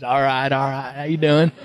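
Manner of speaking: cool, easygoing movie-star greeting; Matthew McConaughey. Retold or imitated. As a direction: mimic a relaxed Southern swagger. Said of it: "it's Matthew".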